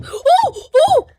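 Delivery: high, loud cries of alarm; two sudden high-pitched "oh!" yelps; startled yelps. Real, not acted. Player spots another piggy in the game, startled yelps.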